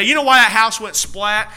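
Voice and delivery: usual speaking voice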